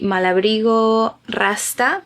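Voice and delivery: Spanish accent on the yarn name; Spanish pronunciation. Real, not acted. Knitter naming her yarn as a Spanish speaker, Spanish pronunciation. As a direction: say Malabrigo the Spanish way. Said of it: "I need to say it the way it is".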